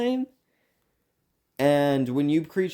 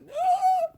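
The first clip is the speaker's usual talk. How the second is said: mock gasp; 3 dB louder than usual. Mock panic about being doxxed.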